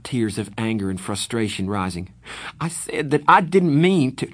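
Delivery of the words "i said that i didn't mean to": frustrated. Acted. Pony insisting, frustrated.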